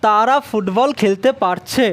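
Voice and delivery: normal talking voice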